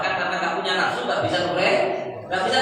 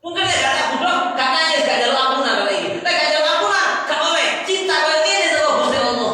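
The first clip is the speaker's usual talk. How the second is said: high, forceful aggrieved griping; exasperated devil; 8 semitones higher, 4 dB louder than usual. Acted. Devil regretting he ever tempted the man, high exasperated protest. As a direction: rattle out the grievance high and worked-up.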